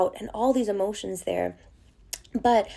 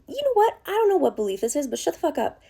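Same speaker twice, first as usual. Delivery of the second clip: defiant dismissal; 6 semitones higher, faster than usual. Retold or imitated. Speaker telling her limiting belief to shut up, defiant. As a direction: shove the belief aside with scorn.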